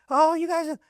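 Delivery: high, mocking fawning tone; mock impressed voice. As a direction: mimic the sudden admirers, way up high.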